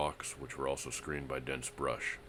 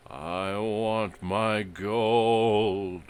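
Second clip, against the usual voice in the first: high, slow, wavering wail; high quavering ghost voice; 7 semitones higher, 10 dB louder, much slower than usual. Acted. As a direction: moan it like a ghost. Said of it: "Page quavered in a high-pitched voice".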